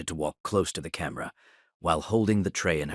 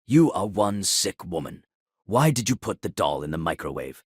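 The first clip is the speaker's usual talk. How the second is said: usual speaking voice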